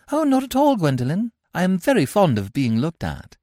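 put-on a touch of girlish politeness; lightly voiced young girl. Cecily voiced in read play.

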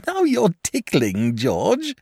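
high, slow and coy, with a giggle. A narrator's reading. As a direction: dragon being poked under the foreleg, coy. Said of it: "said the dragon coyly".

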